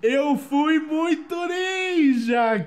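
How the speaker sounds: high, loud, drawn-out boasting yell; exultant shout. Real, not acted. Gamer boasting of a narrow dodge, shouting exultantly. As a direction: shout it in triumph, stretching the words. Celebrates dodging the hammer.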